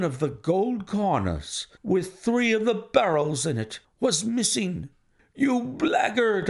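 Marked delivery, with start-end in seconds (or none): 5.3-6.5 high, drawn-out outraged insult; enraged cry. A narrator's reading. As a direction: stretch the insult out in high-pitched rage. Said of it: "I shouted".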